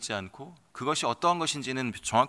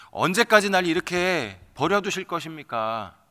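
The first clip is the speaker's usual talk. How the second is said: 언제까지 pushed higher and louder, pleading; louder, higher second plea of 언제까지; anguished cry; 4 semitones higher, 6 dB louder than usual. Acted. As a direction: repeat it as a rising outcry to God. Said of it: "절규를 하는 것이죠".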